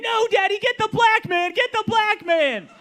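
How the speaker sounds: put-on high, loud frightened child pleading; scared child. Daughter cowering in the corner, begging daddy, high scared yell. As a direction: scream like a terrified little girl. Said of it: "cowering in fear, going".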